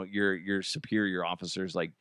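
usual speaking voice

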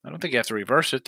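normal talking voice

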